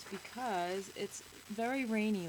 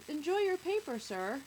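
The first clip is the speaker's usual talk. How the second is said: high, chirpy vendor, dropping on the last word; friendly news seller; 7 semitones higher, 4 dB louder than usual. A narrator's reading. News guy to the old man, chirpy. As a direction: hand it over with a chirpy lift. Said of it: "The news guy says".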